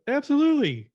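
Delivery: very high, loud exclamation; hammed-up, unhesitating retort; emphatic certainty. Husband answers the Queen about favorites, emphatically sure.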